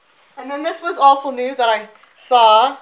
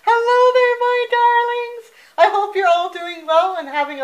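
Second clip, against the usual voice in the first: sweet hostess voice; 10 semitones higher than usual. Acted. Sing-song greeting to viewers.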